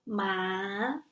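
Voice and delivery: long rising "ma"; second tone. Shown as a demonstration. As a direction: sweep "ma" upward from a low start. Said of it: "The second tone is a rising tone".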